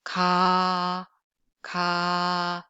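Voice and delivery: drawn-out plain Korean "Ka"; plain lax k. Shown as a demonstration. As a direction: say "ka" relaxed, held out evenly. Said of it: "let's just practice one more time".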